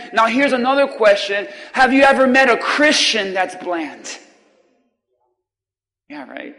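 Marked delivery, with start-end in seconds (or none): none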